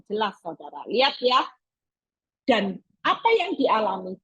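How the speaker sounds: ordinary delivery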